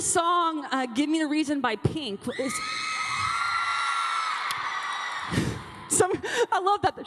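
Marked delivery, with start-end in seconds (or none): 2.6-5.8 squealing laugh. Real, not acted. Excited about the song.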